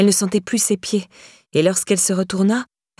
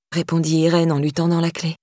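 ordinary delivery, nothing marked

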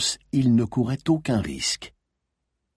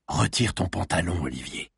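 lower, softer, commanding order; 4 semitones lower, 5 dB quieter than usual. A narrator's reading. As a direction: drop the voice into a low command. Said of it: "Sa voix descendit encore dans les graves".

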